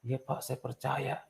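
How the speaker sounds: jawaban pelan, lambat, agak tinggi; patuh dan lirih. Retold or imitated. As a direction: tirukan ibu menjawab dengan lembut. Menirukan jawaban ibu yang percaya. (menjawab lirih penuh percaya)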